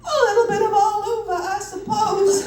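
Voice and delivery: fib in a hammed-up Southern accent. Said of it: "I lie".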